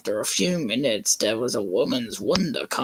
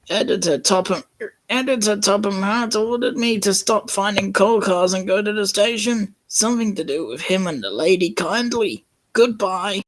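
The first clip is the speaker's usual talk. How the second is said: raised, slightly higher character read; higher engine voice; 9 semitones higher than usual. Acted. James telling Percy his orders, higher voice. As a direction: report the orders up high.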